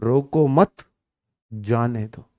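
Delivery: "रोको मत" together, pause, low soft "जाने दो"; pause after मत. Shown as a demonstration. Teacher showing second reading, pause after मत. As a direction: break after मत, letting जाने दो fall away. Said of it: "एक होता है".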